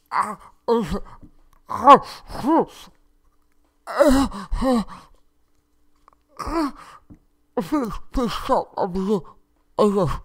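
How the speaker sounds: high groans and a pleading through a gag; muffled high gag groans. Acted. Gagged prince begging the captain to stop, muffled and high. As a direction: plead while being gagged. Gag being put back in.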